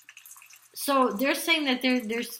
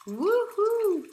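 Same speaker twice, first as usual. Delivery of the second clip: high, bright whoop of joy; sudden high-pitched celebratory woohoo; excited cheer; 11 semitones higher, 3 dB louder than usual. Real, not acted. Cheering the engagement card.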